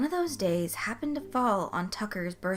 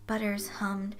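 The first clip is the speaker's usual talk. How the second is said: usual speaking voice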